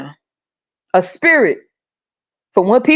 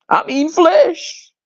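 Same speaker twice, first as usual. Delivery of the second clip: mock churchgoer; 7 semitones higher, slower than usual. Voicing a churchgoer's reply.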